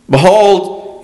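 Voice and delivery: "Behold" lifted high and firm, falling; emphatic behold. Shown as a demonstration. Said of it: "illustrating the emphatic word".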